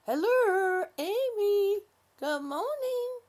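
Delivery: bright sing-song hello, pitched high and loud; cheery greeting. Real, not acted. Greeting a viewer's guest warmly.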